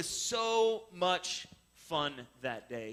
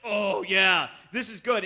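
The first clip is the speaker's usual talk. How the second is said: excited; 8 dB louder, slower than usual. Retold or imitated. Own glee at hooking fish.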